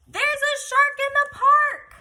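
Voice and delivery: put-on high, loud alarmed shout from a boy. A narrator's reading. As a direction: belt out the warning. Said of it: "he's about to shout".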